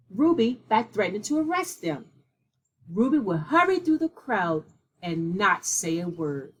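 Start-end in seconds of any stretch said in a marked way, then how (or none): none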